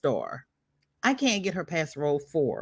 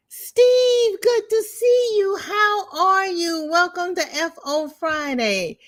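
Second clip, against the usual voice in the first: high, loud, bright hello to a viewer; excited greeting; 12 semitones higher, 4 dB louder than usual. Real, not acted. Host greets Steve joining the live stream, high and loud. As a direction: sing out a welcome.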